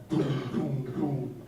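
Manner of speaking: low, deep booming knocks; mock door knocks. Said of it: "knocks on the door".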